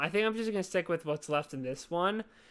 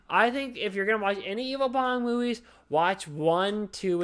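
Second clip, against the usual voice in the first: ordinary talk, nothing marked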